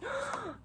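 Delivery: high, quick intake of breath; excited gasp. Real, not acted. Excited to read her new book, excited gasp.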